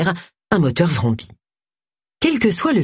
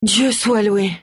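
exclaiming; 4 semitones higher, slower than usual. Acted. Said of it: "s'exclama Agatha Raisin".

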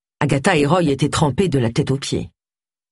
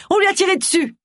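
alarmed, high-pitched cry; faster than usual. A narrator's reading. Agatha alerts bystanders that Roy was shot, crying out. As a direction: call out the emergency to everyone around. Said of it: "cria-t-elle".

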